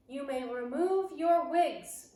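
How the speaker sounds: slow, loud, high snarled command; rasping foreign-witch snarl. Acted. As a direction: snarl it with contempt. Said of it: "she snarled".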